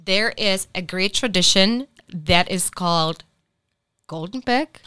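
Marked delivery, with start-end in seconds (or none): none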